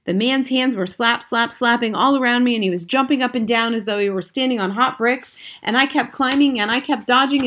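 plain reading voice

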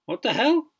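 an angry, high-pitched burst of protest; angry outburst. A narrator's reading. Startled recruit at asylum gate, angry outburst. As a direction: recruit snaps in shock at the stranger. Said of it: "began Britain angrily".

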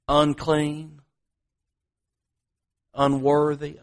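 usual speaking voice

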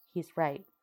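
plain reading voice

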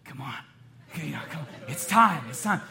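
acted inner nudge, waiting for praise; coaxing impatience. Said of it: "I'm thinking".

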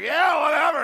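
high, loud dismissive yell; hammed-up careless holler; mock shout. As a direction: toss it off loudly like a drunk golfer. Golfers' dismissive retort, retold.

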